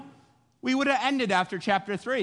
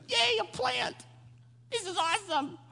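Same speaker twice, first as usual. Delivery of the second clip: very high, squeaky little cheer; mock happy; 12 semitones higher, 4 dB quieter than usual. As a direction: squeal it with silly delight. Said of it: "the first time Jonah's been happy".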